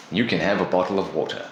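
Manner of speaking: ordinary talk, nothing marked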